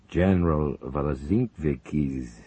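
slow, grave Zelinkov voice; Soviet leader. Acted. Zelinkov telling his conspirators of a death, slow grave. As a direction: let the news sink in.

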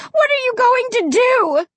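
very high-pitched panicked cry; terrified scream. A narrator's reading. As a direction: scream it in a voice unlike your own. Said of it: "I screamed in a voice".

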